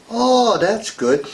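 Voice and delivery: drawn-out, high-pitched cooing "oh"; mock approval. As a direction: play an over-impressed listener, slowly. Acted polite reaction to ancestry boasts.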